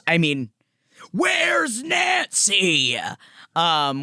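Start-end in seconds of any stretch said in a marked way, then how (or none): 1.1-3.0 long, high, drawn-out holler; mock scream. Cohost mimicking the public still searching, mock screaming. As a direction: bellow it out slowly, hammed-up. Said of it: "we're still screaming it out here".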